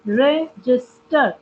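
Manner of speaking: Register slowly, broken into three parts; syllable by syllable. Shown as a demonstration. Teacher showing students three syllables, register split by syllable. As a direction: pause twice inside register. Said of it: "two times you have to give a break".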